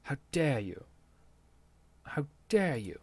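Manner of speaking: lightly voiced mocking imitation of an indignant girl; mock Greta quote. Podcaster mocking Greta Thunberg's quote, lightly imitated. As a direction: echo the viral line with a hint of outrage.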